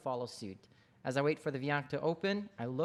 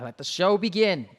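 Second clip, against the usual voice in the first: loud royal command; commanding shout; 4 semitones higher, 11 dB louder than usual. Acted. King orders the performance to start, loudly. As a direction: call it out to the whole court.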